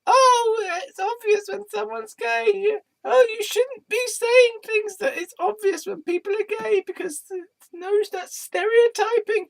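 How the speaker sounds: very high falsetto, drawn-out scolding; mock scolding objector. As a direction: mimic a squeaky, preachy critic. Mimics an imagined scolding objector.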